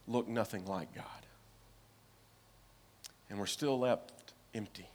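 whisper. Real, not acted. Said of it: "whispered sermon close".

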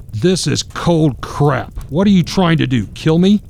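put-on a drunk father's sneering outburst; spitting it out. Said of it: "then spits out".

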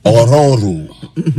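slow, drawn-out name in African accent. Shown as a demonstration. Husband shows off the car's name, African accent. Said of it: "gotta say it in an African accent".

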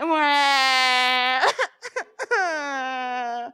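playful fake sobbing that turns to laughter; mock crying laugh. Shown as a demonstration. Showing the children how crying sounds, half laughing. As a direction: fake a cry and dissolve into laughs. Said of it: "What might her crying sound like?".

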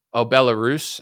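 usual speaking voice

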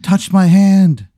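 dummy's request. Acted. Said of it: "He goes".

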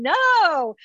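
high squeal of refusal. Retold or imitated.

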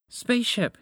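high-pitched urgent call; warning shout. A narrator's reading. Moose warns spaceship of trees, high shout. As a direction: cry out the name in warning. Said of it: "he shouted".

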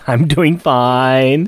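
very slow, stretched words; drawn out. Pretend. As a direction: stretch it out on purpose. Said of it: "I kinda am".

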